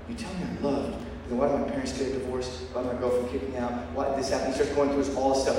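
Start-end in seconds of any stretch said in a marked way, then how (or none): 0.1-4.5 softer, a touch of hurt; a hint of a broken young man asking. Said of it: "he's just like".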